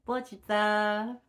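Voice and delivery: loud, high, drawn-out name; announcing call. Real, not acted. Reader naming the chosen pile, playful loud call. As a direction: sing it out with a held last syllable.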